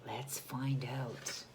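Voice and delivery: slow breathy whisper, building suspense. A narrator's reading. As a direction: tease the next page softly. Whispered teaser to children.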